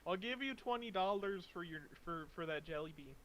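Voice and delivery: high and loud, eager buyer. Retold or imitated. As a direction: gush over the jelly bean. GM voicing gullible adventurer.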